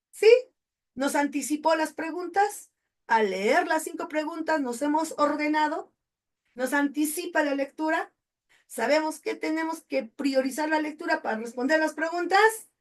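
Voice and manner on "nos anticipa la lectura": usual speaking voice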